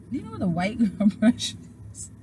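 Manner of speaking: retold question broken by a laugh; laughing while quoting herself. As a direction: giggle through the words. Laughing while repeating the childhood question.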